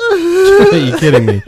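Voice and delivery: high, slow disbelief, voice cracking; incredulous. Real, not acted. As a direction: stretch it out in disbelief. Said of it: "disbelief at the story".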